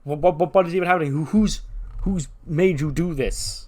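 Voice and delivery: acted flustered outrage; flabbergasted. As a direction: sputter questions, slowing at the end. Teacher flustered by students' complaint.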